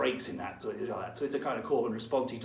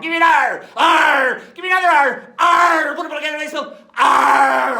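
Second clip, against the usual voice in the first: loud, high cheer; pirate rallying shout; 13 semitones higher, 13 dB louder than usual. Acted. Teacher leading a pirate cheer, loud shout. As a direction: whip the crew up with shouts. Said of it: "cheer calling for R".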